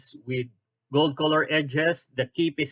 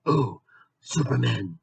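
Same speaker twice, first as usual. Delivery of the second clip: low, slow superhero voice; Batman; 5 semitones lower than usual. Acted. Batman caught out by Superman, low and slow. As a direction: drop low and drag the words.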